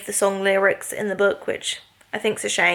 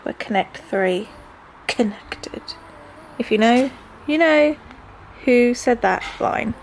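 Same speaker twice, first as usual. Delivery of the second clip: ordinary talk, nothing marked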